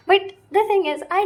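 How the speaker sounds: sudden excited. Real, not acted. Excited outburst about solitude.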